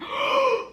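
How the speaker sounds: sudden high gasp of shock; a sharp, high gasp as the score appears. Real, not acted. Vlogger seeing her passing score, sharp high gasp. Sees she passed exam.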